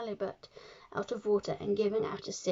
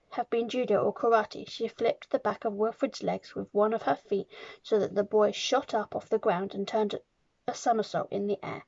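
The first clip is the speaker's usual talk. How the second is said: ordinary delivery, nothing marked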